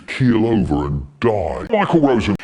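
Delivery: mock shouted curse; shouted threat. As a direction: pitch it low and stretch the last word. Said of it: "someone shouting".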